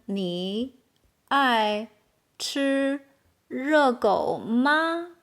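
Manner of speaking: slow, a pause after each word. Shown as a demonstration. Teacher modelling a question for Chinese learners, slow and word by word.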